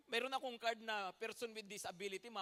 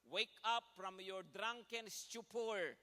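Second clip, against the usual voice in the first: slow reading; much slower than usual. Real, not acted. Reading scripture slowly.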